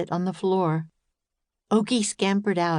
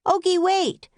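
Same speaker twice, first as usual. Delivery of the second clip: high urgent shout after the dog; 12 semitones higher, 3 dB louder than usual. A narrator's reading. Annie shouting after the runaway puppy. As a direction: yell for him to stop. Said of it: "shouted Annie".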